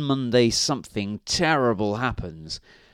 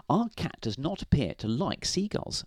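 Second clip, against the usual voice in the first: high, soft wifely voice; light, high storybook wife; Missus Grinling; 5 semitones higher, 5 dB quieter than usual. A narrator's reading. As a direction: put on a soft woman's voice. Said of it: "said Missus Grinling".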